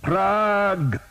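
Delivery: high, loud shout; war cry. A narrator's reading. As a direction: hurl the name as a rallying cry. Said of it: "they shouted their war cry".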